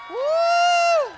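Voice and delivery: high, loud, long "woo"; triumphant whoop. Acted. Comedian celebrating winning the whole contest, triumphant whoop. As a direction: let out a piercing victory whoop.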